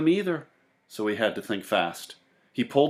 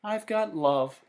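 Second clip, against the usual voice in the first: put-on higher, slower boy voice; Sam content; 7 semitones higher, slower than usual. A narrator's reading. Sam explaining to the old woman, slow higher voice. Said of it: "he said".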